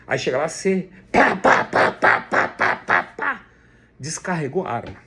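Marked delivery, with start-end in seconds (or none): 1.4-3.5 breathy unvoiced "pá pá" bursts, louder; gunshot sounds. Preacher imitating a rookie firing wildly. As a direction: fire off imitated shots.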